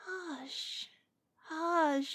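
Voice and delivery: drawn-out shushing, falling gently; calm a scared kitten; tender hushing; soothing hush. Acted. Soothing a frightened pet.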